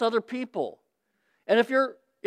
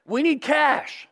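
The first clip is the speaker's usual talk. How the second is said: put-on bold kid asking; 4 semitones higher than usual. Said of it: "They're, they're bold".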